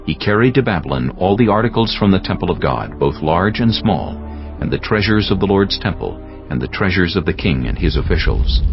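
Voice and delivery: normal talking voice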